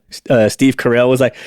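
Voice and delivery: ordinary talk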